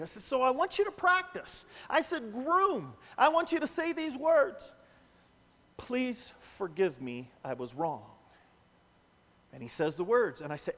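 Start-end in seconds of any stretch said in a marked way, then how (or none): 0.2-4.7 higher, lively instruction; commanding the groom. Retold or imitated. Pastor directing groom at altar. 5.8-8.0 slow, lower, soft apology; gentle confession. Shown as a demonstration. Modelling the apology words.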